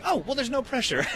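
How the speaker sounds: mock carefree. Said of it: "you're like".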